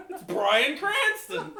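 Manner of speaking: slow, high, laughing exclamation; excited. Retold or imitated. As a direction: gasp it out starstruck.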